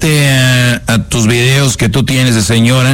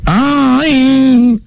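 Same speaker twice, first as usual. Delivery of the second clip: put-on swooning girlfriend; 14 semitones higher than usual.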